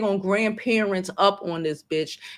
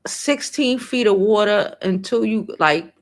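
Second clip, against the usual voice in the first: ordinary talk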